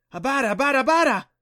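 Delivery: high, loud creature cry; excited creature calls. A narrator's reading. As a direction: chirp it like an excited beast. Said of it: "The Abada sounded excited".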